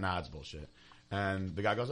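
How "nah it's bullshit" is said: deadpan dismissal. Retold or imitated. Booth owner re-enacting his joke on customers, deadpan. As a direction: lower and softer, throwaway. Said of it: "I literally just went".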